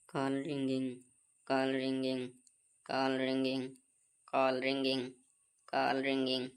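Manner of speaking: phone ringing. Acted. Son waiting for father to pick up, low droning ring. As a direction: drone the ringtone low and even.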